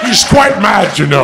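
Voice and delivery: mock Scottish actor voice; Sean Connery. Player cutting in on bad accents, mock Scottish. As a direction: toss off a hammed Scottish impression. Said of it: "I just threw my Sean Connery".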